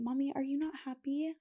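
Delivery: put-on soft, high small-child question; imitating little son. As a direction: ask mom timidly, high and very quiet. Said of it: "and Miller said".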